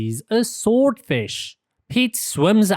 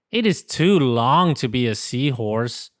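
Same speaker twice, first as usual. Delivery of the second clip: plain reading voice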